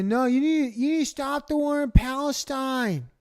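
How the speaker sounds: mocking a protester, high and whining; whiny protester voice. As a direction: mimic a preachy protester blocking traffic. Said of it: "They're stopping you and say".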